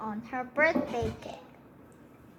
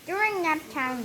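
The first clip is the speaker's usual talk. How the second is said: plain reading voice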